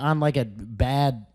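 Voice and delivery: ordinary delivery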